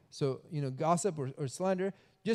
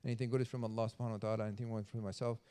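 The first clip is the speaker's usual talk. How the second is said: soft, fast formulaic closing; closing prayer; 6 semitones lower, 8 dB quieter, much faster than usual. Real, not acted. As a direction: murmur the closing dua quickly.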